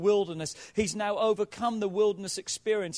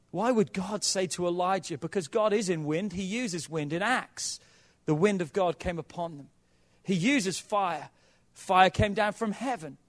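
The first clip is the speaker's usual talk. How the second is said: ordinary talk, nothing marked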